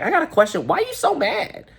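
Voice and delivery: high mock caller voice, climbing very high on the question; Shay the caller. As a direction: squeak out the question in a put-on caller voice. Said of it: "she's like".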